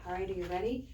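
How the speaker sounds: ordinary delivery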